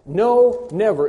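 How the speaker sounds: "no" high and loud, then "never"; two loud, separate blows on "no" and "never"; emphatic double negative. Shown as a demonstration. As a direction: punch both "no" and "never".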